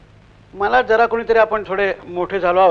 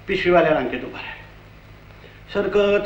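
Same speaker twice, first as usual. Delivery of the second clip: ordinary delivery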